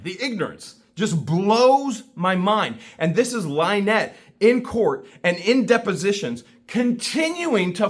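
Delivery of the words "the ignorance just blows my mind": slow, each word weighed; slow down and let it sink in; deliberate, slowed-down disbelief. Real, not acted. Exasperated rant about ignorance.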